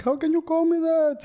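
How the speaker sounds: very high imitated outraged reply; mock indignation. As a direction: squeal it in mock offence. Retold indignant reply in elevator story.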